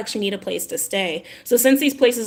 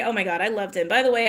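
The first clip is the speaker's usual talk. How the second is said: usual speaking voice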